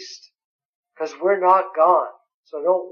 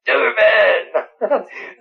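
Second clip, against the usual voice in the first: a short delighted laugh, higher; 6 semitones higher than usual. Real, not acted. Laughs at the German verse.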